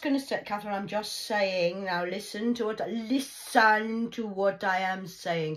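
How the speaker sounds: insistent, loud grandma nagging; grandma lecturing. Retold or imitated. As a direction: lay down the law like a nagging gran.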